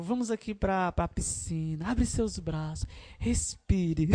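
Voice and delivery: soft, low calming invitation; hushed, low guiding voice; soothing worship leader. Acted. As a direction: ease the tired crowd down gently. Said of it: "Eu vou apaziguando".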